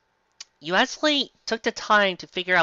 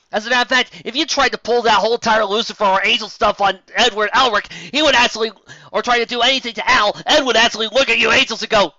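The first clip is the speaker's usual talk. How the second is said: higher, louder heated tirade; fired-up rant; 5 semitones higher, 4 dB louder than usual. Real, not acted. Reader rants that Ed beats the Winchester brothers. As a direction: build the argument up to a shout. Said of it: "heated fan rant".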